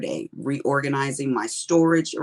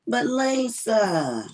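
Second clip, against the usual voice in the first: drawn-out, high Louisiana accent imitation; cute Louisiana accent; 7 semitones higher, much slower than usual. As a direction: imitate a friend's Louisiana accent, stretching it slowly. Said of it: "with her cute little accent".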